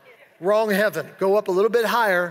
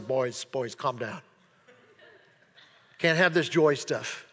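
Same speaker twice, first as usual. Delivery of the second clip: mock stern Jesus; 8 semitones lower, 4 dB quieter, slower than usual. Mock scolding Jesus they reject.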